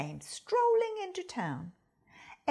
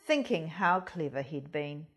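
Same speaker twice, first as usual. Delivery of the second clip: plain reading voice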